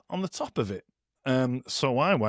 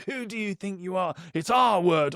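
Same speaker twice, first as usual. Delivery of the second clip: mock indignation; 5 semitones higher than usual. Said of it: "It was like".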